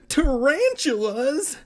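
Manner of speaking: high, loud, drawn-out shriek; horrified cry at full volume. A narrator's reading. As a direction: shriek the word slowly and theatrically. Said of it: "Evan shrieked".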